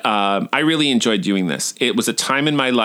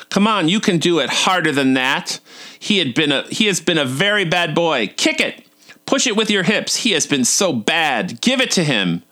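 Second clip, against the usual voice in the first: higher-pitched imitation of an urging instructor; coach egging on; 5 semitones higher than usual. Kickboxing instructor urging a flailing student, imitated egging on. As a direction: egg them on in a put-on, raised voice. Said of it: "he said".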